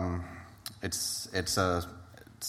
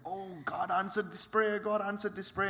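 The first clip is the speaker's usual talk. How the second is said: amazed joy; 11 semitones higher, 4 dB quieter than usual. Retold or imitated. She was so amazed.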